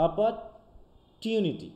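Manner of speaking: অপরটিউনিটি drawn out slowly with a t sound; slow American pronunciation. Shown as a demonstration. Teacher modelling the American opportunity. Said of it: "USA তে বলছে কি".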